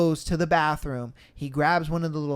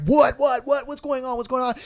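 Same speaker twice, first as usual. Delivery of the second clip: startled; 8 semitones higher than usual. Retold or imitated. Alarmed by the scream.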